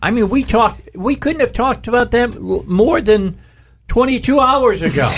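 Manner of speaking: high, excited disbelief. Real, not acted. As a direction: pitch shoots up in amazement.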